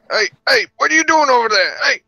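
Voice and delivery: high, loud alarmed call; shouting out. Acted. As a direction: call out sharply across the room. Calling out to a companion.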